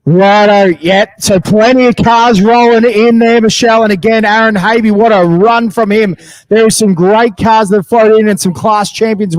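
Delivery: booming announcer over the loudspeakers. Real, not acted. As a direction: call the cars in like a trackside announcer.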